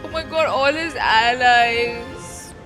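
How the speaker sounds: usual speaking voice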